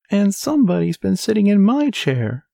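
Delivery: very high, shrill little voice; squeaky baby bear. A narrator's reading. Baby bear finding his chair sat in, shrill squeak. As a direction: squeak it out like a tiny bear cub. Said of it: "squeaked the baby bear in his shrill little voice".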